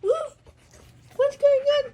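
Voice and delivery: high, playful doggy voice asking what's up; dog-talk voice. Pretend. Owner to her howling dog, high playful dog-talk voice. Owner mimics dog's howl.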